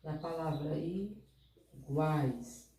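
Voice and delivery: slow teaching emphasis. Shown as a demonstration. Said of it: "as três vogais ficaram juntas".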